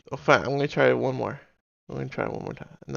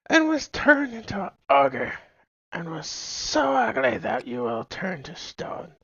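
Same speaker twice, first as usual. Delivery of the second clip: high, slow storybook witch voice; 6 semitones higher, slower than usual. Acted. Streamer to chat, slowly telling the ogre curse in a high witch voice. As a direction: draw it out like a fairy-tale witch. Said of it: "I'm not singing this".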